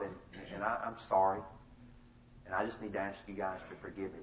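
acted contrite father, low and soft; solemn apology. Retold solemn apology to his kids.

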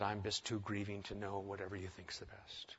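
breathy whisper, barely audible; hushed, grieving whisper to the pastor; retold whisper. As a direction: whisper it like you're too grieved to think. Retold grief-stricken reply to pastor.

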